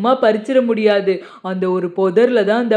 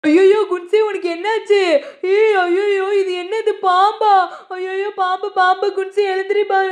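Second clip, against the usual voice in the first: put-on high, frantic child calling for mom; a scared kid crying out that his friend was bitten; high-pitched boy in alarm, calling for help; panicking little boy; 8 semitones higher than usual. Pandi panics over snake bite.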